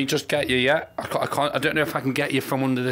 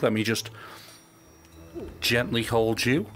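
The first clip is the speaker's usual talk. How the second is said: gentle; 6 semitones lower, 5 dB quieter than usual. Real, not acted. Picks up a skull softly.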